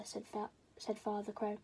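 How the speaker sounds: usual speaking voice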